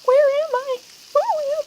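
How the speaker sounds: very high, dazed questions; mock bewildered. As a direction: sound lost and squeaky. Mock confused.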